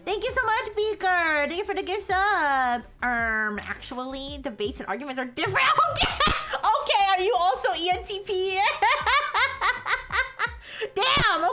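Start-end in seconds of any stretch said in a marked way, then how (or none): 0.0-2.8 cute anime streamer. Real, not acted. Thanking gifter in high voice. 6.0-11.5 laughing. Real, not acted. Laughing at chat's correction.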